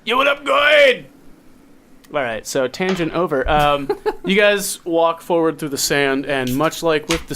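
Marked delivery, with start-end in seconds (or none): 0.1-1.1 high, loud bro call; New York bro greeting. Pretend. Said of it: "They call him Goids".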